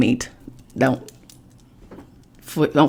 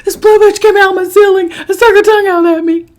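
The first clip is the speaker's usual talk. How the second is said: far higher, much louder frantic yell; hysterical shriek; 9 dB louder than usual. Retold or imitated. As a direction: scream it all in one breath. Retelling her panicked scream.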